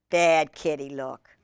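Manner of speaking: ordinary delivery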